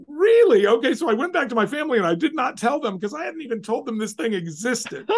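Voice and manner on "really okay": mock surprise.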